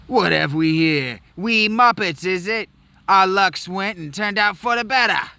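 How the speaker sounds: put-on a failed Cockney accent, over the top; bad Cockney. Thief gloating at the Muppets, in bad Cockney. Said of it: "supposed to be like a bad Cockney".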